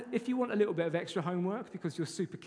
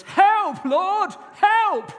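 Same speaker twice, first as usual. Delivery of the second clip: desperate high shout of help; shouted prayer; 15 dB louder, much slower than usual. Shown as a demonstration. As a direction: cry it out at the top of your voice. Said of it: "It goes".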